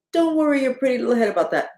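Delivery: high, quick, condescending coo; mock patronizing. Leader brushing off people who want information, mock patronizing. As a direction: placate them in a high, sing-song mock reassurance. Mimics leaders placating their followers.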